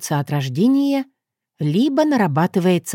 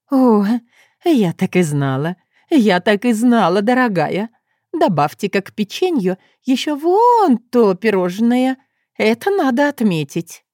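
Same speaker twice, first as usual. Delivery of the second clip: excited; 5 semitones higher, 4 dB louder than usual. Acted. Delighted Mrs Wetterby exclaims.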